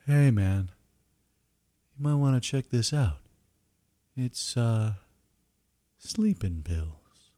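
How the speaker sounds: lightly voiced lazy inner voice; slow dopey nudge from his brain; stoned brain voice. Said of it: "a ganglion nudges a dendron".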